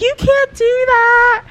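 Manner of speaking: high-pitched, slowed, frantic mom scolding; panicked mom. Retold or imitated. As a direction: shriek it out like a terrified mother. Mom panicking after the near-miss.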